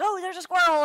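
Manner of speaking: high, loud and suddenly excited; mock distracted dog. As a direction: blurt it like a dog spotting prey.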